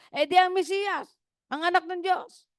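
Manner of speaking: high, lightly voiced old grandmother; grandmother's voice. Grandmother answers her grandson's question, a high mock grandma voice. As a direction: answer like a proud old lady. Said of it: "grandmother answers".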